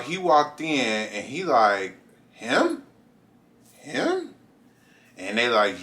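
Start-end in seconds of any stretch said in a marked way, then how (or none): 2.3-4.3 high, rising double-take; shocked disbelief. Retold or imitated. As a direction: principal can't believe it, pitch soaring. Principal shocked seeing the student.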